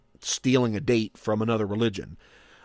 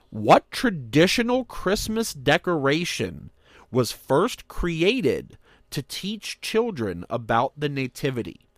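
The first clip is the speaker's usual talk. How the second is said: ordinary talk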